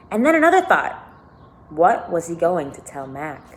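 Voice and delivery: lively read-aloud narration; expressive storybook reading with lifted quotes; story reading. A narrator's reading. Reads novel aloud to listeners.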